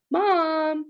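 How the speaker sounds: high urgent call for Mom. Acted. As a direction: call upstairs for Mom, worried. Boy calls mother urgently.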